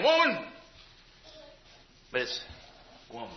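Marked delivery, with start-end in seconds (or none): 0.0-0.4 "Woman" snapped high and sharp; harsh woman. Shown as a demonstration. Preacher shows the wrong, rude tone of woman. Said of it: "he's not saying". 3.1-3.3 "Woman" said gently, soft and low; kind woman. Shown as a demonstration. Preacher shows Jesus calling his mother, tender. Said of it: "in kindness and sincerity".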